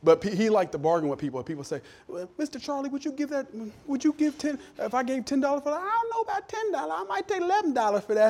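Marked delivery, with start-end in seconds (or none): 2.1-5.8 high-pitched imitation of a haggling customer; put-on higher voice, feeling out a price. Customer to Mister Charlie over ten dollars, haggling voice. 5.8-8.3 very high, hammed-up country accent; grandpa's country accent. Mister Charlie haggling with a customer, exaggerated country accent. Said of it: "he had this country accent".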